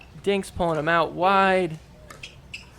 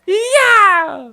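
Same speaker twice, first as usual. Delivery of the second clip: long, loud high victory yell; mock triumphant shriek; imitating pumped celebration; 11 dB louder than usual. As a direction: yell it like the point is won. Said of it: "sticking as high up in the air".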